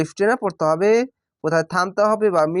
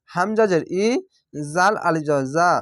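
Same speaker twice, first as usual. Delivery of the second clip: normal talking voice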